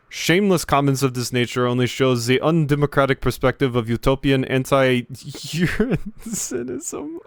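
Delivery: put-on lightly held German bureaucrat accent; prim German official defending himself. Gamer voicing the German official claiming to represent the bureau, German accent. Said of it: "type of speech here".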